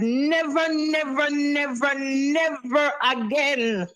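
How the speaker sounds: each never hammered, building; emphatic repetition. Real, not acted. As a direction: pound every never. Caller vows emphatically.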